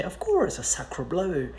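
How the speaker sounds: hammed-up English accent; mock English tourist. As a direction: imitate a proud English speaker showing off French. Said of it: "English people think it's very cool to say that".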